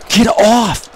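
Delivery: high, loud get off; yelling at the wrestler on screen; angry yell. Real, not acted. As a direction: bark it at the screen. Yelling at opponent.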